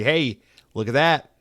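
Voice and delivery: normal talking voice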